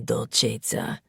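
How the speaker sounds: low, unhurried drawl of the pet name. A narrator's reading. He teases her with the pet name, drawling. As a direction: let the word roll out slowly and low. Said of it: "he drawls".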